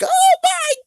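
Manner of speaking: very high, drawn-out scream; hammed-up high-pitched horrified wife; wife's shriek. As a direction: shriek it in mock horror, stretching each word. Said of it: "the freaking wife was like".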